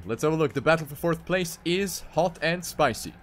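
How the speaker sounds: ordinary talk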